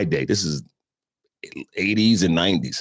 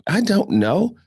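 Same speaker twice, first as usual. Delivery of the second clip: clueless; 14 semitones higher, slower than usual. Retold or imitated. Retold clueless answer at store.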